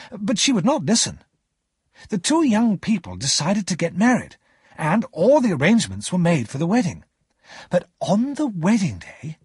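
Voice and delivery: lightly acted, lilting character voice; lightly voiced young storyteller.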